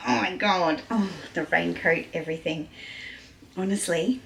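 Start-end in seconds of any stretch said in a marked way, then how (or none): none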